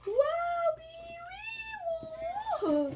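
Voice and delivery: very high squealed wow and wee; shrieky, playful cheering; excited whooping. Real, not acted.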